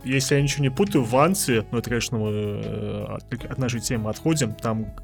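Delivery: ordinary talk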